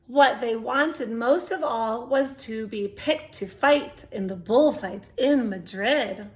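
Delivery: plain reading voice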